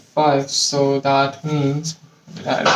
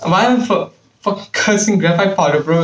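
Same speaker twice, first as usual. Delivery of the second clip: louder and higher, baffled at himself; exasperated rant, voice pitched up; exasperated disbelief; 5 semitones higher, 4 dB louder than usual. Real, not acted. Laughs at own cursing.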